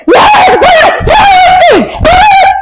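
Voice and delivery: hammed-up shouting back and forth; mock shouting match. Shouting match over sky colour.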